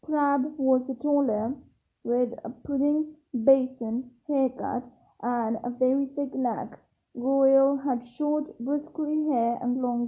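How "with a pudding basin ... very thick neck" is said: ordinary delivery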